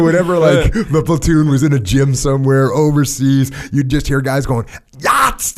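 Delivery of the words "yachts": mock primal yell, high; primal grunt yell. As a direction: bark it out like a lifting war cry. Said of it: "this primal noise".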